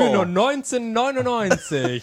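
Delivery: slow, high sales pitch with a laugh; infomercial. Acted. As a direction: pitch the price like a TV host. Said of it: "Wenn Sie jetzt anrufen".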